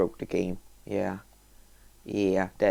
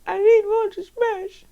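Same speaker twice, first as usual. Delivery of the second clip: high, louder whiny demand; high whiny plea; 5 dB louder than usual. Pretend. Gamer whining for more things to smash. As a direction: whine for more.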